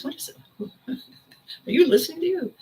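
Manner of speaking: laughing through the question, pitch jumping high; laughing friend. Retold or imitated. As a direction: tease her with a laugh as a friend would. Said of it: "the one who laughs at me".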